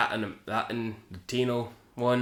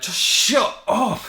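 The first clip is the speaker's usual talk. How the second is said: exasperated; 12 semitones higher, 9 dB louder, much slower than usual. Real, not acted. Exasperated aside at character.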